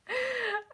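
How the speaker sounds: squeaky cry-like laugh; mock whimper laugh; high whimpering laugh. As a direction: let out a high pained giggle.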